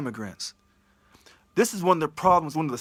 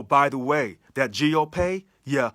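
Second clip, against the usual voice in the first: ordinary delivery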